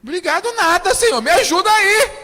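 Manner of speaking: high, loud cry for help; desperate plea. Retold or imitated. Preacher acting a desperate believer crying to God, loud. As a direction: beg God loudly, at the end of your rope.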